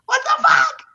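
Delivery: very high disbelieving squeal; incredulous outburst. Real, not acted. Outburst over surprise casting.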